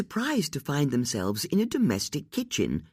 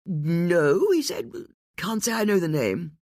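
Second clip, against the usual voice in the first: nervous; 6 semitones higher than usual. A narrator's reading. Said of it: "made a big play".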